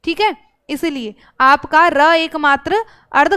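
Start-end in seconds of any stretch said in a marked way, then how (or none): none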